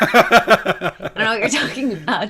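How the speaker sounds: feigned innocence breaking into laughter; laughing mock denial. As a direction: deny it while cracking up. Repeats joking denial through laughter.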